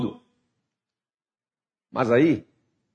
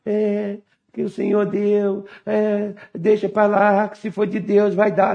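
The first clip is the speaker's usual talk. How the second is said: mock passive believer in a higher monotone; resigned believer; 5 semitones higher than usual. (imitação de crente conformado)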